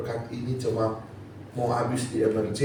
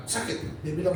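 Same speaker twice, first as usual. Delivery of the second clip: usual speaking voice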